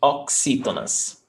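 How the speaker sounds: corrected "Oxítonas" with ks for the x. Shown as a demonstration. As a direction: say "Oxítonas" as ok-SEE-to-nas. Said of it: "the pronunciation of the X is x".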